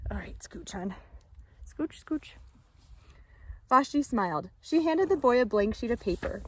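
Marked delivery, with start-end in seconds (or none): 0.1-2.3 soft whispered nudge to move over; hushed aside. Real, not acted. Teacher shooing a child aside.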